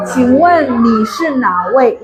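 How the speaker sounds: slow, courteous question; very polite. Shown as a demonstration. Secretary answering an unknown caller, slow and polite. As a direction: ask slowly, every syllable respectful. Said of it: "Itu sangat sopan sekali".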